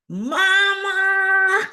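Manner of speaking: very high, loud, long cry for mother; frantic wail. Retold or imitated. Pregnant daughter phoning her mother, frantic wail. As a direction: wail it out like a distressed child.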